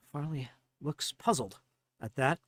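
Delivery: normal talking voice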